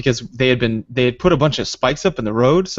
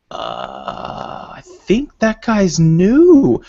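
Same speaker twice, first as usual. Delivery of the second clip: mock dawning surprise; 7 semitones higher, 5 dB louder, much slower than usual. Spotting new player through scope.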